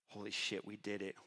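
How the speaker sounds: low, soft disbelief; hushed amazement. Retold or imitated. Promoter looking round at a sold-out fight night, hushed amazement. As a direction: let it out quietly, stunned at what you did. Stunned realization at a packed venue.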